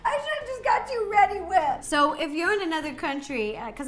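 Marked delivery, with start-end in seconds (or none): 0.1-1.8 high-pitched words squeezed through laughing; helpless laughter. Real, not acted. Baker gives up on sister's icing, helplessly laughing. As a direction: barely get it out for laughing.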